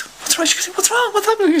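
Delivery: worried sister. Retold or imitated.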